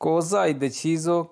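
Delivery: very slow, each syllable clear; slow model sentence. Shown as a demonstration. Teacher reading the Italian phrase for students, very slow. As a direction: read it out slowly for learners to repeat. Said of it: "कोई बंदा मुझे इस तरह आंदा है".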